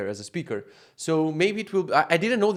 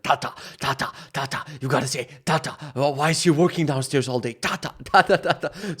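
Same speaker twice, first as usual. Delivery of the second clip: coaxing grandma. Retold or imitated. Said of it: "all I hear is, like, her telling her".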